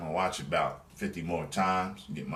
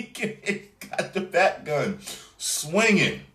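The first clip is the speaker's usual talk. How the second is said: high, louder, slow laughing delight; laughing excitedly; 10 semitones higher, 5 dB louder, slower than usual. Real, not acted. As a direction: giggle it out with glee. Excited laughing about favourite shot.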